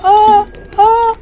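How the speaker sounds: high excited yelps. Real, not acted. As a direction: burst out in excitement. Excited by winning move.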